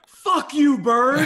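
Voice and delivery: mock a furious yell at a friend; retold shout of outrage; pissed-off retold yell. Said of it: "Oh, he was so pissed".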